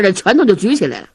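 ordinary delivery

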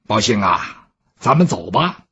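a master quietly calling his servant; Bao Gong's voice. Acted. Bao Gong calls Bao Xing to leave, deep and soft.

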